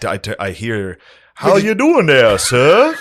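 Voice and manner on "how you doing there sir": high, slow, loud put-on greeting; mock reenactor greeting. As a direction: ham up a cheerful plantation greeting.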